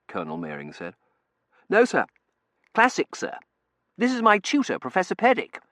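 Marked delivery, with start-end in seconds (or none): 1.7-5.6 put-on young man voice. Terence character voice.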